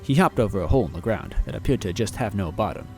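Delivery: plain reading voice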